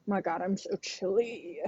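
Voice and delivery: usual speaking voice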